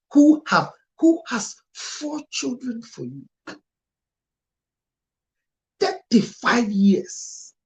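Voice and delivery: shouting slow and outraged about the thirty-five years; outraged outburst. Real, not acted. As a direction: burst out in disbelief. Outraged at husband's betrayal.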